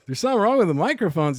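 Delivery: acted puzzled double-take; surprised echo. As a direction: echo it back in disbelief, fast. Startled echo of the runner's warning.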